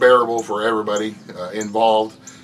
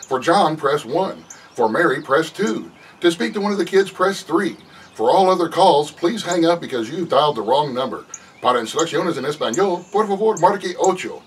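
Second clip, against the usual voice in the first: raised, loud, bright-toned robotic menu read, slowing on the options; automated phone menu; 5 semitones higher, 3 dB louder than usual. Retold or imitated. Man pranking a caller with a fake phone menu, robotic voice. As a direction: play a cheerful answering machine to fool the caller. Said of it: "I went".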